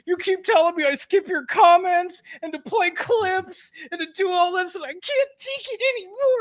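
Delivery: high-pitched, whining complaint rising toward mock tears; mock whiny streamer. Mocking a streamer who blames chat, whining up into fake tears. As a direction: ham up a self-pitying streamer blaming chat. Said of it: "me coming on here and saying".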